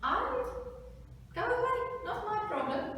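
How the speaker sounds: high-pitched dismissive chicken voice. Acted. Chicken turns the mouse away, high and dismissive. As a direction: brush them off in a high put-on voice.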